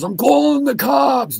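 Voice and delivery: high, slow shouted warning; bystander's mock shout of a warning. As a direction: yell it out to scare them off. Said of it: "This one guy yells".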